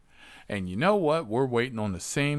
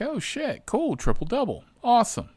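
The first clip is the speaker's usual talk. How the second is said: high excited delight. Retold or imitated. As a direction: jump much higher, thrilled at the fare. Excited at getting ride.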